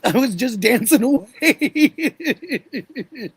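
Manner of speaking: slow, high and laughing. Real, not acted. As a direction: drag it out through a laugh. Laughing memory of the market.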